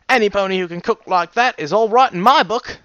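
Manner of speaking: hearty, emphatic cook character; firm stallion cook. Acted. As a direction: declare it heartily in the pony's put-on accent. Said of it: "he firmly stated".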